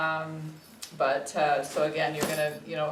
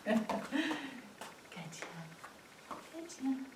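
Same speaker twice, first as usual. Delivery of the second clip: higher, hushed pet talk; soft coaxing praise; 6 semitones higher, 10 dB quieter, much slower than usual. Real, not acted. Trainer helping puppy up on an elevation, soft reassurance. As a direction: reassure softly in a lifted voice.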